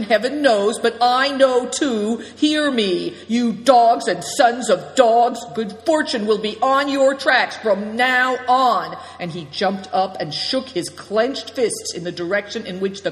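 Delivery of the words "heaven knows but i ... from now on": loud, slow, higher threat; shouted vow. A narrator's reading. Good Fortune cursing the vanished thieves, loud shouted threat. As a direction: hurl the curse after the thieves.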